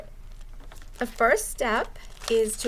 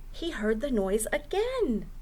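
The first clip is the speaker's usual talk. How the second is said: lively storytime narration; reading to children; 4 semitones higher than usual. Acted. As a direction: read it like a children's librarian at storytime. Librarian reads picture book aloud.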